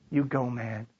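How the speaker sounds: very low, soft and calm; hushed, low blessing; gentle reassurance. Acted. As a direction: quietly send him on his way. Jesus gently answers Peter.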